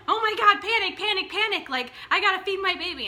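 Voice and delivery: high, loud, frantic "panic, panic"; a mother in a rush to feed her baby; mock panic. Mother acts out a frantic night with a hungry baby, high and loud. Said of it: "No, she's like".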